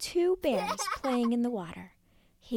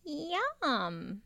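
forced yum; 6 semitones higher than usual. Acted. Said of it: "He tried to sound".

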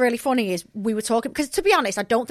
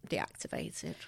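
whispered; 7 semitones lower, 17 dB quieter, slower than usual. Real, not acted. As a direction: whisper the word deactivated. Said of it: "deactivated (whispered)".

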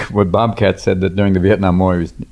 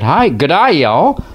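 higher Australian twang sliding into Southern drawl; Aussie-Southern mix; 7 semitones higher, much slower than usual. Shown as a demonstration. Robin showing the blended accent, Australian-Southern mix. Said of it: "you get this".

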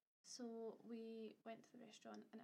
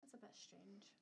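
whispered aside; 11 dB quieter, slower than usual. Real, not acted. Quiet aside.

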